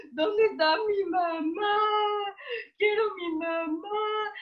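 mock hysterical sobbing for mamá; hysterical crying. Lost child wailing for her mother at customer service, hysterical. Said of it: "empecé a llorar así histérica".

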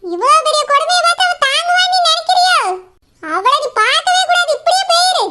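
high, mocking scold of the runaway groom; angry older woman. Mother scolding the groom who ruined her daughter, furious and mocking. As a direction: tear into him like an outraged mother.

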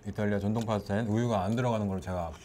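chewing while talking; talking around food; mouth full of pasta. Real, not acted. Seen 3: Man facing camera chews with pursed lips and puffed cheeks while talking.

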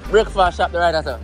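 ordinary delivery

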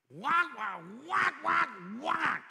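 loud high squawking whack whack; shrill nagging whacks, hammed up; wife's voice as whack. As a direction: imitate harsh yelling as whacks. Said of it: "That's what it sounded like to me".

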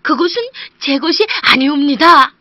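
honest woodcutter insisting it isn't his; humble woodcutter. Acted. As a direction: protest politely, a bit anxious.

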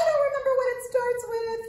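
high, loud excited puppet voice, partly sung. Acted. Puppet Arturo greeting the children, high excited voice. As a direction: bounce in as an eager little monkey puppet.

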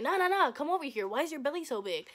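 imitated suspicious father; dad's demand. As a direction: call them back insistently. Dad stopping her to question.

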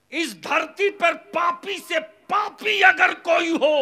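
high, thunderous shouted tirade; full-force preaching. Real, not acted. Preacher condemning fathers who sell their sons, full-force shout. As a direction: roar it slowly and forcefully at the congregation.

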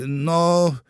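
distracted hesitation. A narrator's reading. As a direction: stall while trying to focus. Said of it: "nie mogła się skupić". (przeciągłe, niepewne wahanie)

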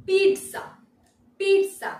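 "পিৎজা" as PEET-sa, high and loud; emphasized t. Shown as a demonstration. Teacher giving the correct pronunciation, "পিৎজা" as PEET-sa. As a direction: punch "পিৎজা" with a clear t before s. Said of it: "টি এর ওপর একটা".

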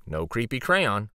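usual speaking voice

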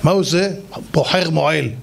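normal talking voice

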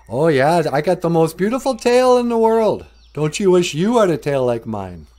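high, loud bragging; boastful. Acted. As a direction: crow loudly about your own prize possession. Proud beaver boasts to rabbit.

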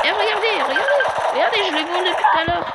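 high, loud, fast excited chatter. Real, not acted. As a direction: rush it out, thrilled to show. Excitedly showing gameplay.

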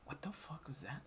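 shocked, hushed whisper; retold whisper. Imitating Sophia aghast after the outburst, whispering. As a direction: hiss it under your breath, imitating a friend.

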